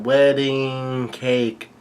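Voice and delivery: long, slow, singsong call; drawn-out mock yell. Big Mom calling up from the hole, drawn-out mock yell. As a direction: stretch the first word way out. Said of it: "still yelling out".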